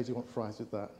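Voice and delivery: softly joking fries line. Pretend. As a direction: toss it off low like a fast food clerk. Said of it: "just say".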